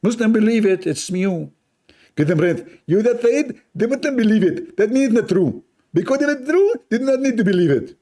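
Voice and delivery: very high, loud mock preacher, fast at the end; shrill-free high mock debater; imitating Zakir Naik. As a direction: parody him arguing back. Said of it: "Brother Zakir Naik is speaking".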